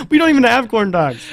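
high, loud, mock-nervous outburst; nervous movie quote. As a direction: imitate his panic, pitched high and loud. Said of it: "he was nervous about the number".

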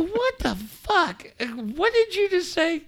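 very high, drawn-out incredulous squeak; comic high-pitched astonishment; mock disbelief. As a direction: play stunned in a high, slow voice. Retold shocked reaction to son.

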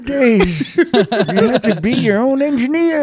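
put-on high, creaky old man voice, loud and laughing. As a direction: play a grumpy old-timer. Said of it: "Give me the old man voice, Crooked, 'cause you do it good".

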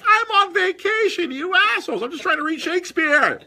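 mock-outraged Picard yelling at time travelers; exasperated outburst. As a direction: whine it like a fed-up captain on leave. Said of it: "And I'm like".

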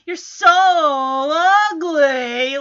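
"so" and "ugly" stretched out long; imitated insult, lingering on "so" and "ugly"; drawn-out insult. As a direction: draw out "so" and "ugly" mockingly. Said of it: "she draws out the words".

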